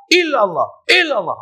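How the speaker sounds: 'illallah' chanted high and falling, complete; correct dhikr. Shown as a demonstration. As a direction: model of an acceptable chant. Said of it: "Ha, tu okey". (sebutan 'illallah' yang betul)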